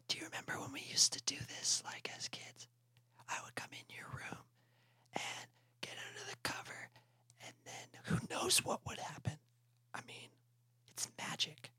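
breathy intimate whisper under the covers. Acted. Toad whispering to Frog under the covers. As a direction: whisper it like a secret in bed.

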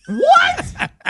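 high laughing shout of disbelief; shocked shout. Retold or imitated. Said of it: "And I said".

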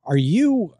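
usual speaking voice

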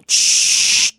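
unvoiced aerosol "chhh"; spray sound. Shown as a demonstration. Don Cheto cueing the caller with a spray sound. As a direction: imitate a long deodorant spritz. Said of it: "Hasta que escuche el ch".

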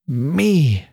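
drawn-out, bitter exclamation. A narrator's reading. Annie repeating her reply to being chosen, bitter. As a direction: stretch ME out bitterly. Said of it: "repeated Annie with a terrible, bitter accent".

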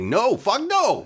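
Charlie's angry refusal. Retold or imitated. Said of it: "Charlie in the back who's like".

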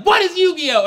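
high, loud demanding question; mock-outraged question; parents' baffled yell. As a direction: imitate bewildered parents yelling.

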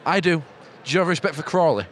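usual speaking voice

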